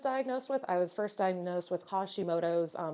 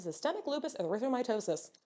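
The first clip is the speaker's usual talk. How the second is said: rapid, softer last repeat; tongue-twister fast; 2 semitones higher, 5 dB quieter, faster than usual. Shown as a demonstration. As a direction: rattle off the medical mouthful. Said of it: "Say that three times fast".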